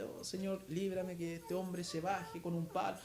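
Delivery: soft, slow murmured plea to God; silent inner prayer. Retold or imitated. Driver praying inwardly while the taxi blocks him, soft plea. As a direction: pray under your breath, barely voiced. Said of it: "Empecé con mi mente".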